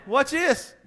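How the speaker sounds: shorter, high whine; repeating the scared soldier's question in a whine; whining. Acted. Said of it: "Yeah, whining".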